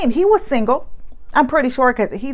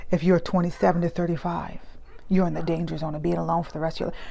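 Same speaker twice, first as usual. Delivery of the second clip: low, softer imitation of a solemn man; mock-serious; 3 semitones lower, 7 dB quieter, faster than usual. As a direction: imitate his grave warning, quietly. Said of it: "he was, like, so serious".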